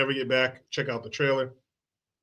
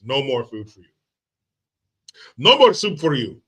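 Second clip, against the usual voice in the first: mock Soup Nazi; 5 dB louder than usual. Mocking RFK as a food-denying tyrant.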